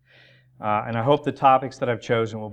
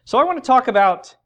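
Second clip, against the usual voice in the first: higher, louder, driving preaching; 7 semitones higher, 5 dB louder than usual. Acted. Preacher urging the congregation, at full preaching force. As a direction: press the point home to the church. Climax of sermon intro.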